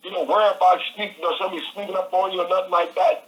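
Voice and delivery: pumping himself up, you're free; excited realization. Retold or imitated. Telling himself he's free.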